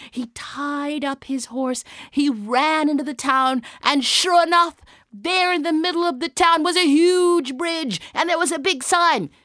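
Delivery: high, loud storytelling build-up; breathless-with-excitement storyteller; excited narration. A narrator's reading. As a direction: race toward the big reveal, pitched high.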